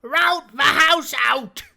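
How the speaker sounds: high, loud, commanding pirate; blind pirate voice. Acted. Blind beggar ordering his crew to scatter, commanding shout.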